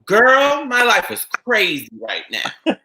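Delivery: high, loud, slow diva exclamation, drawn out. Retold or imitated. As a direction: belt out "Girl" way up high, then drag the rest slowly. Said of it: "and she goes".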